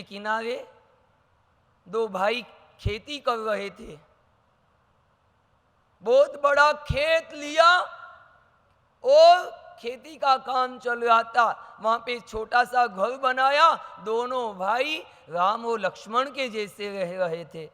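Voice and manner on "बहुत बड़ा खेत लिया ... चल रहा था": story narration. A narrator's reading.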